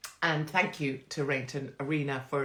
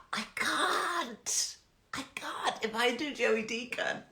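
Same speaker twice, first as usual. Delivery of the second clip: high whispered refusal; 7 semitones higher, slower than usual. Real, not acted. Whispered to the viewer who asked for Joey Deacon.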